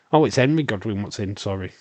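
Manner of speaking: ordinary talk, nothing marked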